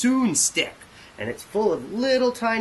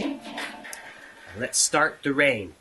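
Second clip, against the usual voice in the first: slow dramatic announcement; 4 semitones lower, much slower than usual. Acted. Host opens the rain effect.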